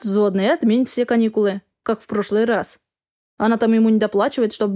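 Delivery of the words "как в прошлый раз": plain reading voice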